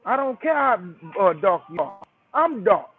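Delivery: ordinary talk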